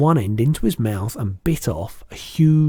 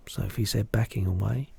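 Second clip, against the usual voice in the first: usual speaking voice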